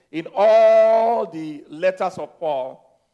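ALL drawn out long and loud; emphatic ALL. Real, not acted. Preacher ranking this among Paul's letters, hugely stretched ALL.

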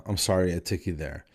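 ordinary talk

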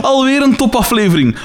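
high, amused exclamation; geamuseerde uitroep. A narrator's reading. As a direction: read it high, as if just done laughing. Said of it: "lachte Daan na afloop". (hoge, geamuseerde uitroep)

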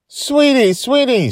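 high, loud delighted calling; excited. A narrator's reading. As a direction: call out to her with joy. Grandma rushes to hug Joy.

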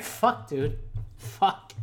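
very soft, fading to a whisper; quiet defeated cursing. Retold or imitated. Reacting to a friend's answer about Ronan, quiet defeated cursing. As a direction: curse under the breath, conceding.

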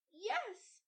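put-on high, slow, delighted child's cry; a small girl marvelling at popcorn; little girl crying out in wonder; excited little girl. A narrator's reading. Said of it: "said Mildred".

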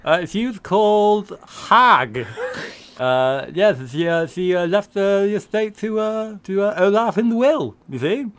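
tongue pressed back, throaty swallowed vowels; swallowed dark vowels. Pretend. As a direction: speak with the tongue pushed down the throat. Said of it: "I press my tongue back sort of down my throat".

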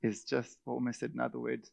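quiet muttering complainer; a hint of a sulky whiner, soft; mock self-pity. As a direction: grumble under your breath, sorry for yourself. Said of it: "to start to go".

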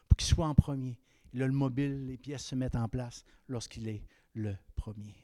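ordinary talk

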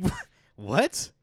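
usual speaking voice

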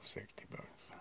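hushed echo; whispering. Real, not acted. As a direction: whisper it back. Echoing in a whisper.

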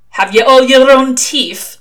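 put-on loud, slow, roaming regional British accent; unplaceable British accent. A narrator's reading. Shadwell interrogating a recruit, unplaceable British accent. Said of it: "Mister Shadwell's accent was unplaceable".

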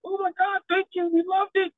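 high-pitched excited crowd voice; mock gushing partygoer; gushing guests. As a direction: squeal thanks like thrilled guests. Guests thanking the DJ.

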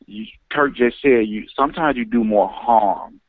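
normal talking voice

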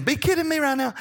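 put-on high, far-off little boy voice saying "Be kidding me right now"; imitating grandson. Grandpa imitating his grandson's cheeky line, high child voice. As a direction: mimic a small grandson's cheeky retort. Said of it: "I say it to him".

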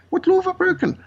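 high-pitched imitation of an indignant protest; mock protesting question, pitched well up; retold protest. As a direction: voice her challenge, pitch jumping up then falling. Retelling arrested mother's protest to police.

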